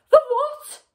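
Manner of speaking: high, loud shriek of alarm; screamed question. A narrator's reading. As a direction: shriek it back in horrified disbelief. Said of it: "screamed Missus Twit".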